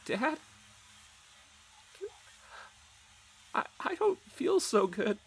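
put-on high, weak little girl's voice; small sick child. Mercy tells her dad she feels ill, high and weak. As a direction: sound like a small girl suddenly unwell. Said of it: "Mercy's small voice".

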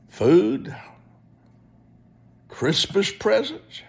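ordinary delivery

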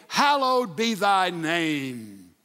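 drawn-out, reverent prayer line; slow intoning. Acted. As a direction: savor each word of the prayer.

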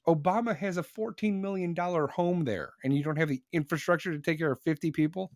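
ordinary delivery

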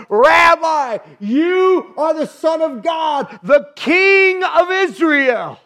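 loud, slow, high exclamation; shouted praise. Acted. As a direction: cry it out to the whole room. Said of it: "he cried out, he shouted".

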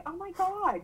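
high, wondering gasp of delight; awestruck. Retold or imitated. Mother watching son swim with dolphins, awestruck. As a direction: let the wonder lift your voice.

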